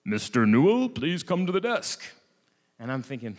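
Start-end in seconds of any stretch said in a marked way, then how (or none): 0.1-2.0 an airport announcer's voice calling a name, a little distant; loudspeaker announcement. Retold or imitated. Airport staff paging a traveler over the loudspeaker, announcer voice. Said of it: "I hear my name over the loudspeaker".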